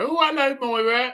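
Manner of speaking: high mock old-lady hello; old lady greeting. Pensioner greeting a neighbour, high mock old-lady voice. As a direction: chirp it like a pensioner in the street. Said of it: "when I used to take my mum out and they go".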